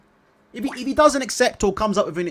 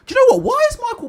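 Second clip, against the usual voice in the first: much higher and louder, fed-up disbelief; exasperated outburst; 6 dB louder, faster than usual. Real, not acted. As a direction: blurt it out, incredulous. Outraged at Benson's omission.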